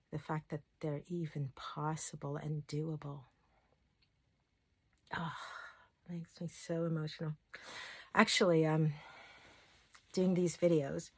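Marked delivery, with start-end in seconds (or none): 5.1-7.3 real whisper, moved and choked up; emotional whisper. Real, not acted. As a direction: let it slip out in a whisper. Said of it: "I'm getting really emotional".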